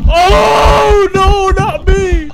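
high loud panicked wailing; panicked pleading. Real, not acted. Being caught and killed in game.

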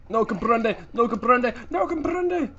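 loud, high frantic yell; panicked mock shout.

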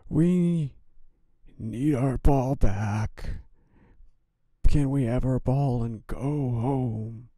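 put-on high, loud scared-kid plea; pleading kid. A narrator's reading. Jimmy begs the scary voice for the ball, pleading.

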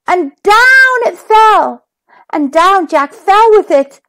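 ordinary delivery, nothing marked

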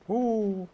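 high loud whoop; surprised high whoop; high hoot. Real, not acted. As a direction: let out a sudden high hoo. Reaction sound.

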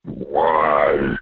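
usual speaking voice

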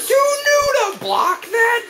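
very high, slow, disbelieving shriek; outraged screech. Real, not acted. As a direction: stretch it into an incredulous screech. Outraged at blocked move, then repeats.

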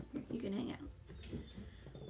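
soft, slow murmur; quiet coaxing to a pet; soothing pet. Real, not acted. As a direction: settle the pet down gently.

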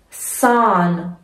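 سان with a long stretched آ; long drawn aa in Zahn. Shown as a demonstration. Teacher stretching سان for German students. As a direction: draw out the vowel in سان slowly. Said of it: "مثل این کلمهٔ سان".

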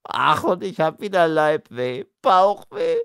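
high complaining whine; whining Monito. Acted. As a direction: whimper about the bellyache. Monito whines about bellyache.

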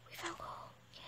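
thrilled hushed whisper; excited whisper. Real, not acted. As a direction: whisper it in delight. Excited whisper after gasp.

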